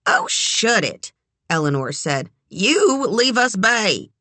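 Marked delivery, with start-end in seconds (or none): 0.1-1.1 high, drawn-out dismissal; exasperated put-down; cross. A narrator's reading. Eleanor snaps at Larry. 2.5-4.1 high, slow warning; indignant, protective rebuke; stern. A narrator's reading. Eleanor orders Larry away.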